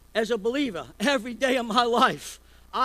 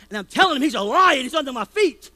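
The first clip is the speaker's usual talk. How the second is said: louder mock yell at the devil; wife telling off the devil, loud; retold yelling; 4 semitones higher, 11 dB louder than usual. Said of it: "she was yelling".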